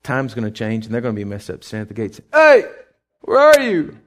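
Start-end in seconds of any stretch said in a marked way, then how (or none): 2.3-4.0 calling out. Retold or imitated. Sheep calling at the gate.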